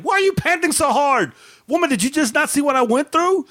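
high, indignant protest to his wife; worked-up retort. Retold or imitated. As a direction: act out the outraged spider-fighter.

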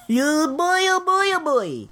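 high, silly put-on voice; comic exasperation. Real, not acted. Host flustered by a phonetic spelling, high comic fretting. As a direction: whoop it up high in mock dismay. Mock despair at botched name.

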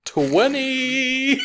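high, loud shout of triumph; excited cheer. Real, not acted. Player cheering a natural twenty, excited shout. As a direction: yell it out as the die lands. Celebrating the dice roll.

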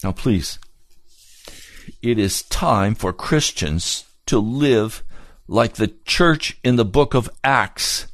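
usual speaking voice

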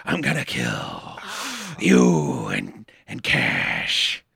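very low, slow villain rasp; sinister low growl, slow and halting; Jack Palance. Retold or imitated. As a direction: draw out a menacing threat from deep down. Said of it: "they just hear".

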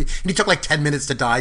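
ordinary talk, nothing marked

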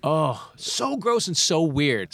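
slow, high disgusted drawl. Real, not acted. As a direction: stretch it out in disgust. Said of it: "it's just gross and weird".

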